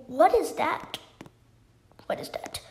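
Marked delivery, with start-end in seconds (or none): 2.1-2.6 whisper. Real, not acted. Suddenly whispering in game.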